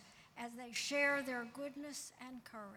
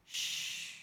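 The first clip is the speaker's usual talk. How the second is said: whispered hush. Acted. Hushing the congregation.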